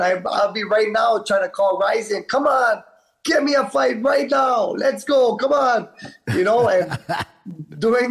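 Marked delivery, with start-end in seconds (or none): 2.3-5.9 high, urgent shouted plea for a fight; acted fired-up fighter calling out, much higher; eager demand. Acts out begging promoter for fight.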